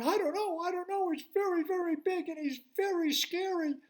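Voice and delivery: high, loud, fearful squeak; frightened high fish voice; scared little fish. Acted. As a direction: quaver with fear, high and urgent.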